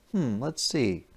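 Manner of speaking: ordinary delivery, nothing marked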